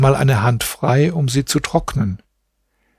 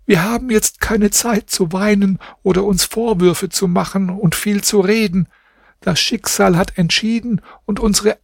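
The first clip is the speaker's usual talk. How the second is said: higher, urgent woman's voice; pleading wife; 7 semitones higher than usual. A narrator's reading. Wife urges her husband to flee, higher pleading voice. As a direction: beg him to save himself.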